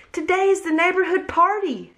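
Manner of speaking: high, bright cheering call; excited shout. A narrator's reading. Mouse shouting the party news in the kitchen. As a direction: call it out with joy. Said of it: "shouted Mouse".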